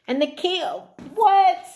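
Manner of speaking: high, loud burst of shock; surprised. Real, not acted. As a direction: gasp out in real disbelief. Shocked by the card drawn.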